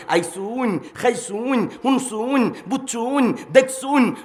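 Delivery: Mymensingh dialect. Shown as a demonstration. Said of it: "ময়মনসিংহের ভাষাটা তাল আছে".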